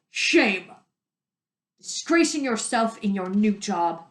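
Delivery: loud, stern mayor's reproach; stern rebuke. Acted. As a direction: shame her in front of all. Mayor scolding girl.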